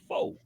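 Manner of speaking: "Four" exaggerated with a New York vowel; imitated New York four. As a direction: mimic how New Yorkers say "Four". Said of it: "only one O and no E in there".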